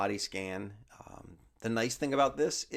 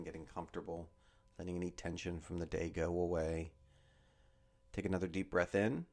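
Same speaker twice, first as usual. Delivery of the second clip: guided meditation; 2 semitones lower, 7 dB quieter than usual. Acted. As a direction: ease them down into stillness, word by word. Leads a body scan meditation.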